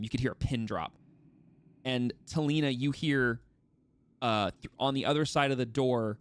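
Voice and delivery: ordinary delivery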